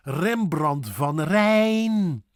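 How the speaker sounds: slow Rembrandt van Rijn with rolled r's; deliberate Dutch Rembrandt van Rijn; Dutch pronunciation. Shown as a demonstration. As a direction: stretch the ei in Rijn. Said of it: "another famous painter".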